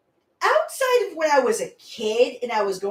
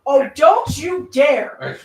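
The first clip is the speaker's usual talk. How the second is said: slow, loud warning shout; drawn-out playful threat; mock threat; 7 dB louder, slower than usual.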